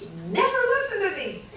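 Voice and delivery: high, fast exasperated yell; shrill retold complaint. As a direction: squeal it at him in frustration. Wife yelling at husband.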